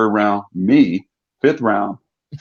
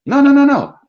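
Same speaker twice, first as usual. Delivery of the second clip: excited reassurance; 5 dB louder than usual. Retold or imitated. Reassuring his alarmed mother.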